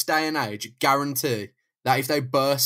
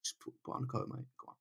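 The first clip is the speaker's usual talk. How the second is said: soft, hushed mock concern; suddenly soft and considerate, half whispered; mock caring thug; 7 dB quieter, faster than usual. Kidnapper gently telling Jameson to put a coat on, soft mock concern.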